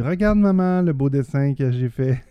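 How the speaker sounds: put-on high, eager child's voice, slowing at the end; proud little kid. A parent imitating a small child showing a drawing to mom, eager. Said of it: "Puis dis".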